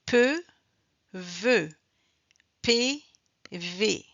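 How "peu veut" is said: "peu" with P, "veut" with V; teacher separating P and V; P against V contrast. Shown as a demonstration. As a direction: say "peu", then "veut", only the first consonant changing. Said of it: "c'est seulement la lettre P et la lettre V qui changent".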